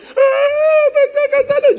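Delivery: high, loud, tearful wail; wailing woman. Retold or imitated. Pastor voicing the maid clinging to his leg, loud high wail. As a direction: cry out like she's clutching his leg.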